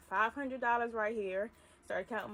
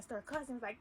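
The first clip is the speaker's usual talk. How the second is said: ordinary delivery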